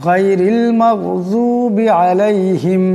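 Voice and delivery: slow মাগযুবি with the Ghain from the throat; careful slow recitation of মাগযুবি; throaty Ghain. Shown as a demonstration. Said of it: "মাগ এমন করে পড়তে হবে".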